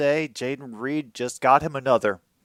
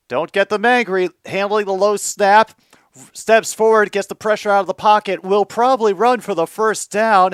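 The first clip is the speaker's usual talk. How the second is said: excited play-by-play; 5 semitones higher, 5 dB louder than usual. Real, not acted. Calling a live play.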